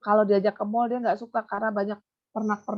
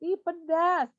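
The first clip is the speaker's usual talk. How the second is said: animated; 7 semitones higher, much slower than usual. Shown as a demonstration.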